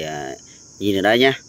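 ordinary delivery

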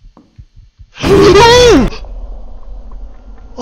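shrill, loud startled shriek; terrified scream. Real, not acted. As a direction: scream in sudden terror. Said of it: "Sorry if I was too loud there".

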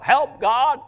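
impassioned praying aloud, high and loud; cry for help. Acted. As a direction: cry out to God, punching each plea for help. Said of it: "I call it a cry for help".

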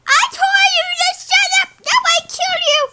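high, strained outburst; mock-angry threat. Telling Blue to shut up before shooting, mock fury.

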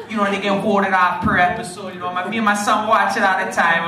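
put-on a rough, hammy Jamaican accent; lightly put-on Jamaican accent, retelling. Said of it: "there's a horrible Jamaican accent".

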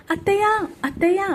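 high, fast cry for aunty; shouting for help. A narrator's reading. Brinda yelling for her aunt while her ear is twisted, high and fast. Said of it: "గట్టిగా అరుస్తూ ఉంది బృంద".

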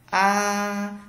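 "a" held long on a high level pitch; long, unbending high "a"; first tone, drawn out. Shown as a demonstration. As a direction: sustain "a" high and even. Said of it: "tức là bạn kéo dài hơn".

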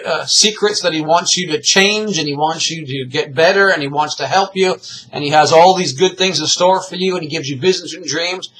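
ordinary talk

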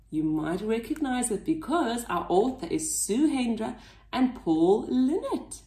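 ordinary delivery

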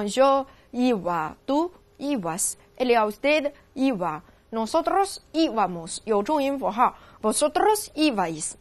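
slow, careful recitation of each form; slow drill. Shown as a demonstration. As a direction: drill the forms slowly, one by one. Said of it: "那这个变位再走一遍啊". (放慢语速逐个带读)

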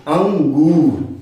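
अंगूर drawn out very slowly with a clear nasal अं; teacher modelling अंगूर slowly for learners; slow careful demo. Shown as a demonstration. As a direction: stretch अंगूर so each sound is heard. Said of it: "उच्चारण कैसे होगा?".

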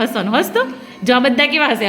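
ordinary talk

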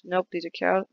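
normal talking voice

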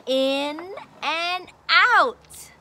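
"in and out" drawn out, falling; slow teaching phrase. Shown as a demonstration. Teacher introducing the lesson words, slow and clear. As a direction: stretch out "in and out". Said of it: "So we're gonna learn about".

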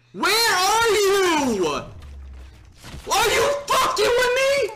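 high furious yell; drawn-out disbelieving shout; raging gamer. Real, not acted. Killed, shouting at screen.

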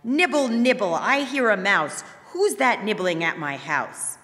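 high, loud, piercing call from inside; shrill witch's cry. A narrator's reading. Witch calling out at the nibbling children, shrill and loud. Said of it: "heard a shrill voice cry out".

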